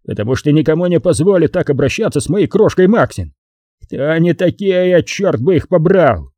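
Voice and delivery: put-on outraged boyfriend, voice pushed up; Hutchins, blustering anger. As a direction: bluster protectively, slowing into the curse.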